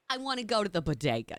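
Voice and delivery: low-register Brooklyn accent; fallen-back Brooklyn accent. Shown as a demonstration. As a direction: let the accent drop down into thick Brooklyn. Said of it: "I keep falling back down".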